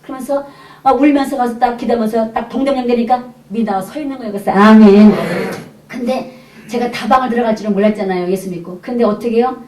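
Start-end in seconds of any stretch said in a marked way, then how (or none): 4.5-5.1 loud amen. Retold or imitated. Greeting friend at the station.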